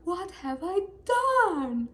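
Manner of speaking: high, loud, slow anguished wail; the king realizing his mistake; dismayed king. Acted. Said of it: "he's like".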